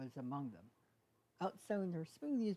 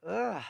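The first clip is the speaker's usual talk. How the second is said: loud fed-up ugh; exasperated groan; 4 semitones higher, 10 dB louder than usual. Acted. Hitch fed up with everyone, loud groan. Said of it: "He rolled his eyes and sighed".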